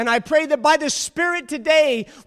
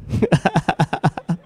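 laughing; 8 semitones lower than usual. Real, not acted.

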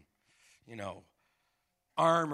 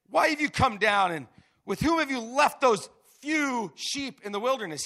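higher, much louder scolding; angry big brother; 8 semitones higher, 11 dB louder than usual. Acted. Oldest brother Eliab scolding David, loud angry rebuke. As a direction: snap at the kid brother for showing up. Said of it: "Eliab's anger burned against David".

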